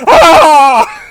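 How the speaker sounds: high, loud hammed-up shriek; horrified shriek. Sorceress shocked by a magic trick, hammed-up shriek. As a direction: scream it high and loud, mock horror. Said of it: "she's fucking horrified".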